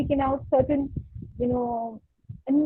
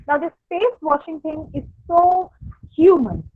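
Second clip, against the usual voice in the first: ordinary talk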